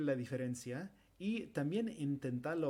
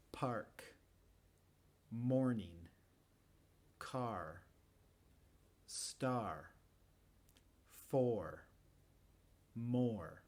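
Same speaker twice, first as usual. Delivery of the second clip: careful American word list, every R sounded; measured American drill, Rs kept in "car" and "four"; American pronunciation; 2 semitones lower, much slower than usual. Shown as a demonstration. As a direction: model each word singly with a Yankee R. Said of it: "con la pronunciación americana".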